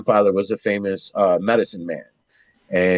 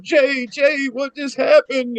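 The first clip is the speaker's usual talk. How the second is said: high-pitched, frantic calling; retold alarm; slower than usual. Friend's friend after the accident, retold alarm. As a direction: imitate a bystander crying out, pitched way up.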